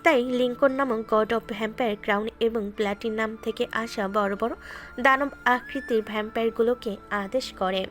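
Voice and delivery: usual speaking voice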